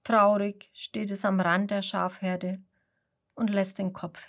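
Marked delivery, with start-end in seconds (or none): none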